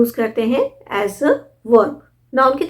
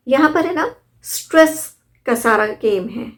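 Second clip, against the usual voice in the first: ordinary talk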